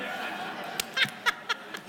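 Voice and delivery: airy high-pitched burst of laughter; high breathy laugh. Real, not acted. Remembering Tom's joke about the head writer, a high airy laugh.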